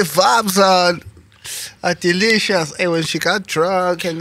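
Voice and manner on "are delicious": ordinary talk, nothing marked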